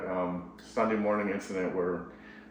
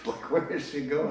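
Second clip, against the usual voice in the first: higher, amused question through a laugh; laughing disbelief; 6 semitones higher than usual. Real, not acted. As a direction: chuckle it out, bemused. Laughing while recalling wife walking off.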